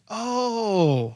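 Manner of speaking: mock-awed, long falling oh; acted awe. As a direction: play a beachgoer struck by the view. Acting out seeing the real beach.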